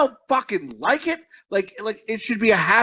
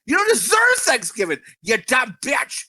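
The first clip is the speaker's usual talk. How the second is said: angry shout; 4 semitones higher than usual. Real, not acted. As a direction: let the outrage burst out. Ranting at rude customer.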